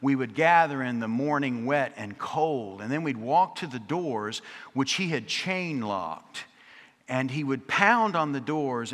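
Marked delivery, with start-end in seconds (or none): none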